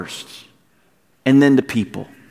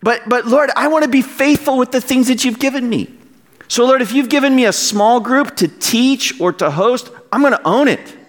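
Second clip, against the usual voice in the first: high-pitched, fervent plea to the Lord; impassioned preacher's vow; passionate; 8 semitones higher than usual. Real, not acted. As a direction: declare it earnestly, voice pitched well up. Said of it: "I'm a little passionate about this point".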